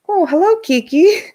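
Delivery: high, bright hello to the dog; excited greeting. Real, not acted. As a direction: sing out a delighted hello to a pet. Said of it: "greeting the dog".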